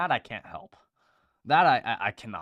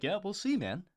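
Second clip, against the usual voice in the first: higher, easy shrug of a line; imitating dad; 13 semitones higher, 3 dB louder than usual. Son imitating his laid-back dad, shrugging it off. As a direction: toss it off like a dad who doesn't care. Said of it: "He's just like".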